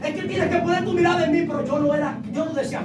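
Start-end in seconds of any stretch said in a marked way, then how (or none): 0.0-1.4 loud, high preaching voice; full-force preaching cry. Acted. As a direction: proclaim it to the whole church.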